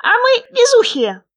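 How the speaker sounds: high, loud, squeaky little frog; small creature's high, loud voice. A narrator's reading. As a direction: pipe up eagerly as a tiny frog. Unseen frog keeps shouting back.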